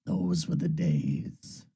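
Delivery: low, soft and drawn out; wistful drawl. Real, not acted. Streamer looks back on past songs, wistful drawl. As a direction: stretch it slowly, dropping low.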